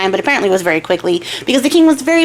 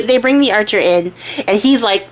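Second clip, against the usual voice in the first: plain reading voice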